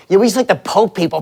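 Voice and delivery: ordinary talk, nothing marked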